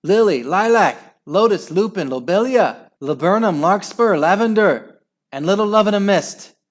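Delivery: put-on father mouse urging his children on; frantic calling. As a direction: rattle off the names urgently. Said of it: "urged on his exhausted children".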